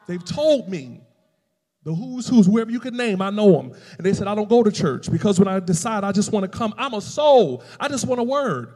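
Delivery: loud, driving sermon delivery; full-force preaching. Acted. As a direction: preach it out, punching the key words.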